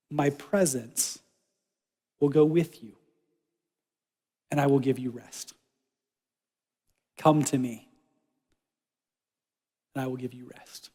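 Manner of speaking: a calm, tender promise from God; gentle God voice. Pretend. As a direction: reassure him, unhurried. God's reply, slow and soft.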